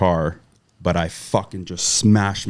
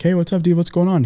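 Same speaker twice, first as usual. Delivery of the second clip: much higher, friendly call out; cheery greeting; 11 semitones higher, 3 dB louder, faster than usual. Retold or imitated. Greeting a cop friend at the neighbor's house, upbeat call. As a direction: hail a buddy across the street.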